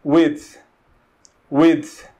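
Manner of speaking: "width" said slowly and clearly, with a short i; pronunciation demo. Shown as a demonstration. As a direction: model "width" for students, word by word. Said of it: "bastante difícil pra gente pronunciar é width".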